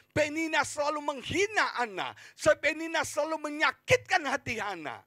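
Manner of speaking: high, loud, driving sermon; full-force preaching. Real, not acted. Preacher telling of Penina taunting Hana, full-force preaching. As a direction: hammer it out to the congregation.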